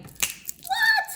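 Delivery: very high, squeaky startled what. Retold or imitated. Jolted off the couch, squeaky surprise. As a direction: jump at the realization. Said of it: "And like".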